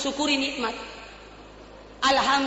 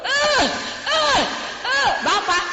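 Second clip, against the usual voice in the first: labor cry; 11 semitones higher, much slower than usual. Pretend. Acts mother crying out in labor.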